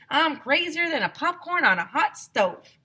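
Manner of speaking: high loud country holler; shouted. A narrator's reading. Teacher hollering about gold fever. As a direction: yell it out wild. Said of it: "shouted Mister Granite".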